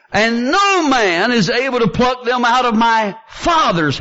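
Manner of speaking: high, slow, emphatic preaching; fervent scripture proclamation. Acted. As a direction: lift and stretch each phrase.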